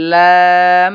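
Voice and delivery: লাম held loud; long loud lam. Shown as a demonstration. Teacher drawing out লাম in the lesson. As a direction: stretch লাম full. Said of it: "এই লাম যে জায়গা থেকে শেষ হবে".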